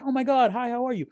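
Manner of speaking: very high, excited mock hello; sweet gushing greeting. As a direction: imitate a delighted barista greeting a regular. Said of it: "they're always so sweet".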